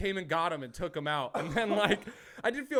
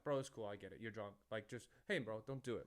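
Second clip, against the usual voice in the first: calm reassuring; 4 semitones lower, 8 dB quieter than usual. Retold or imitated. Calming the drunk heckler.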